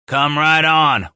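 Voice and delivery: slow, taunting dare; a slow goading taunt. A narrator's reading. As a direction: bait them into coming. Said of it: "Walker goaded the thieves".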